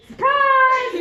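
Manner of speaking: high, loud, drawn-out shout; spring it on everyone, playfully loud; surprise reveal. Acted. Hero unmasking herself before the groom, shouting high. Acting out Hero's unmasking moment.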